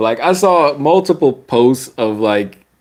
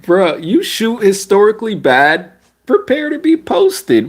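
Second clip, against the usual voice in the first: much higher, teasing taunt to the player; sing-song put-down climbing higher; mock scolding; 11 semitones higher than usual. Vlogger taunting a bad shooter, high mocking sing-song. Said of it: "I'm just like".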